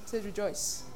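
usual speaking voice

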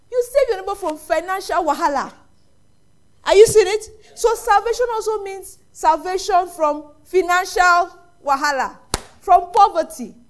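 loud, high, forceful proclamation; emphatic preaching. Real, not acted. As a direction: drive the point home to the congregation. Preacher drives home the point.

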